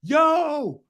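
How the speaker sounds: high, loud call of yo; mock club yell. Host imitates clubgoer yelling across the bar, mock high shout. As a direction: imitate someone yelling across the dance floor. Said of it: "They screaming".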